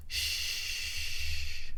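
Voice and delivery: soft breathy shh; quiet him with a finger to his lips; hushing. Retold or imitated. Girl hushing Ant in the car, soft shh.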